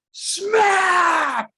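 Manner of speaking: high, loud smack sound effect; shouted smack sound. Retold or imitated. Dad acting out his ball hitting the bully, shouted smack. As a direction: belt out the hit like a cartoon smack.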